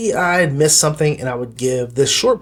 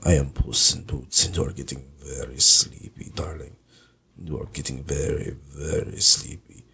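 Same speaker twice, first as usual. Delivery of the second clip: put-on low, whispery, slowing hypnotic Spanish-accented purr; sleepy low Banderas whisper sliding into Count Dracula; Antonio Banderas impression drifting into Dracula; 8 semitones lower than usual. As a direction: lull them to sleep like a seductive vampire. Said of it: "Antonio Banderas talking like this".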